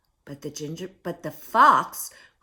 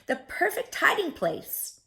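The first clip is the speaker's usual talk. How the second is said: thought aloud; 7 semitones higher than usual. A narrator's reading. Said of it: "he thought".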